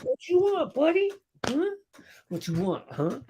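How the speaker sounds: playful trash talk at the dog; taunting the dog. Pretend. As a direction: taunt the dog like a rival wrestler.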